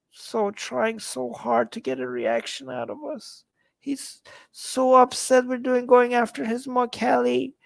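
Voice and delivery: high, soft whimpery pout; mock pity. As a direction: pout sadly on the troll's behalf, high and small. Mocking the upset troll.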